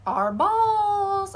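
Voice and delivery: high, loud, drawn-out announcement; excited reveal. Real, not acted. Crafter revealing craft supplies to viewers, excited and drawn-out. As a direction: sing it out like a big reveal.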